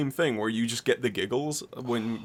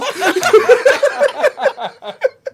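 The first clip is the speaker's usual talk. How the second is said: high, loud peal of laughter; laughing fit; 12 dB louder than usual. Real, not acted. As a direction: crack up helplessly for real. Laughing at the strangling story.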